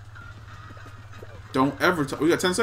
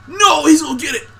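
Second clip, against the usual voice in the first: loud, higher yell of frustration; real angry gamer outburst; frustrated yell; 6 semitones higher, 4 dB louder than usual. Real, not acted. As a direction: shout as the opponent closes in. Yelling as he gets knocked.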